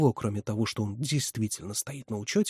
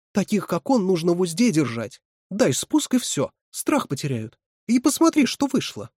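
high, emphatic reproof; exasperated scolding; 8 semitones higher, 4 dB louder than usual. A narrator's reading. Резина lectures Катя on strictness, exasperated. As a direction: chide her with pitch swooping up.

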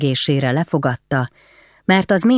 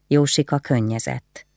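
ordinary delivery

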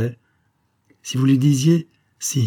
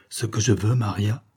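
ordinary delivery

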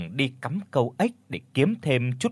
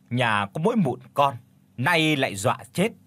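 ordinary delivery

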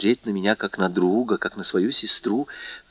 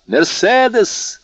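much higher, slow and louder happy call; cheerful call; 12 semitones higher, 5 dB louder, much slower than usual. A narrator's reading. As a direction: call her name joyfully from outside. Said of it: "раздался весёлый голос за дверью". (весёлый зов из-за двери)

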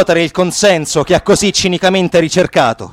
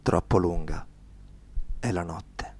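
much softer, lower, fading; hushed echo; 11 semitones lower, 17 dB quieter than usual. Acted. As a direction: let it die away to a whisper. Echoing after the search. (sussurro che si spegne)

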